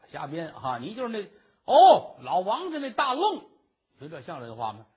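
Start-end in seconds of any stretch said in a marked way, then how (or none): 1.6-3.4 loud, higher fake "oh it's you"; a con man faking sudden recognition; mock recognition. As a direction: pretend you just recognised her.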